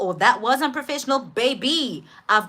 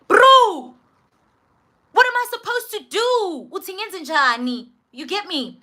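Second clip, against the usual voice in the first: exasperated outburst; 9 semitones higher, 4 dB louder than usual. Acted. Patient alarmed by doctor's vague verdict, high exasperated wail. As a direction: cry out in exasperation, then ease off.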